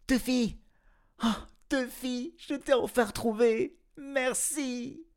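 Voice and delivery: put-on high, joyful girl's voice. A narrator's reading. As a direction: find your lost cat at last. Girl finds her lost cat.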